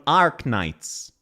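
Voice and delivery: "Arknights" with a stretched, R-coloured "Ar"; imitated wrong vowel. Showing what she's going, exaggerated "Ar". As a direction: copy her lengthened "Ar" in "Arknights". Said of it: "She's going".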